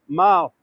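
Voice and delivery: "mall" as "mal", short open a. Shown as a demonstration. Guide telling viewers the Irish way, "mall" with short a. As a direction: say "mall" to rhyme with "pal". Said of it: "short A".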